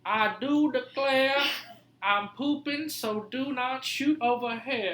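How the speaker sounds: slow, high theatrical Southern drawl; hammed-up Civil War-era Southern speech; old-time Southern accent. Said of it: "They would talk different back then".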